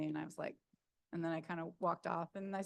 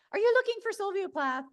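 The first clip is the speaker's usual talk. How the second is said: much higher and louder voice of a friendly English woman; English dog walker; 14 semitones higher, 9 dB louder than usual. Retold or imitated. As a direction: chirp it like a cheery local stranger. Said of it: "she said".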